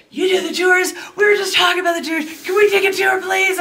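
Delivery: imitating a drunk woman at the bar begging for a tour, shouting; tipsy shouting gal. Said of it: "the one gal's like".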